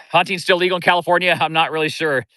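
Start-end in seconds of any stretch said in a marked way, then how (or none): none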